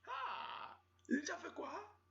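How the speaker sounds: shocked. Retold or imitated. Shock at the mess.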